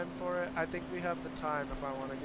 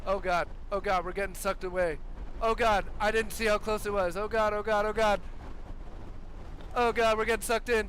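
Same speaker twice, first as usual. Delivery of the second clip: panicked loud oh God cries; frantic, yelling in real fear; terrified gibbering; 4 semitones higher, 5 dB louder than usual. Real, not acted. Sucked into tornado in game.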